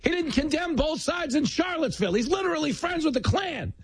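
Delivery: very high mock-alarmed hollering; hammed-up high-pitched outrage; Democrats as hustlers. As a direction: cry out accusations like a street scammer. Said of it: "started walking down the street going".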